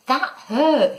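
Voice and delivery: high, indignant snap; cross complaint in a high voice; snapping. A narrator's reading. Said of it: "he snapped".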